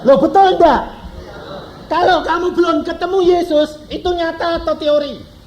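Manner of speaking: high, loud challenge to the congregation; preacher demanding an answer from the congregation, loud; forceful preaching. Acted. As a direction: press the hearers hard, raising the voice.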